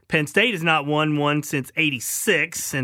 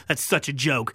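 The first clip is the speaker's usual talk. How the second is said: ordinary talk, nothing marked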